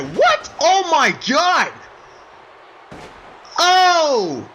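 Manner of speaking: high, loud gasp of disbelief, slowed right down; can't believe what he just saw; jaw-dropped yell of shock; stunned commentator. Acted.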